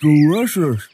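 talking while chewing; mouth full. Real, not acted. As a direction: say it through a mouthful. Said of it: "don't talk with your mouth full".

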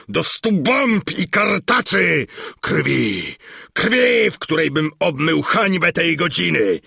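high, loud villain's raging shout; thundering fury. A narrator's reading. Armoured bearded count raging at the proud princess, furious shouted threats. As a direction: roar curses and threats like thunderclaps. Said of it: "posypały się słowa jak grzmoty, a tak gwałtowne i obelżywe".

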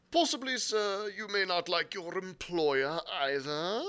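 high, loud, indignant gentleman; indignant squire. Acted. Squire challenging his captain, indignantly. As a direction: needle him, stretching the last words. Squire's dialogue beside narration.

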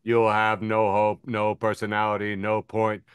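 low, slowed robotic drone; Borg cube voice. Retold or imitated. Borg cube threatening the cheerful woman, low drone. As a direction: list the threats flatly like the hive.

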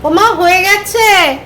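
a real little gasp of surprise at the pot; surprised exclaim. Real, not acted. Rice found already cooked. (অবাক হয়ে জোরে বলা)

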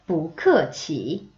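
不客气 very slowly with the tones drawn out; slow model. Shown as a demonstration. Teacher recapping paired phrases, 不客气 slow and careful. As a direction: stretch each syllable of 不客气. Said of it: "이렇게 짝꿍으로 들어갑니다".

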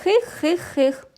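high little creature giggle; a sly hidden hee-hee. A narrator's reading. Sneaky dragon giggling at the kids.

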